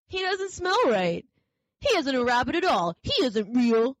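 wild rabbit declaring he's fake; suspicious wild rabbit. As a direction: recoil and accuse him. Wild rabbit sniffing him out.

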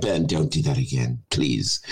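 low, weary mock plea; imitating an unimpressed listener; pleading listener. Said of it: "you're like".

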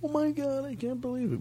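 high, soft falsetto squeal; mock scream. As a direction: pretend to scream in tiny high voice. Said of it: "And then he screams".

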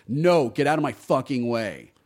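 usual speaking voice

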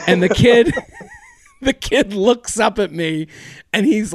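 laughing through the words; can't hold back the laugh telling it; words broken up by real laughter. Real, not acted.

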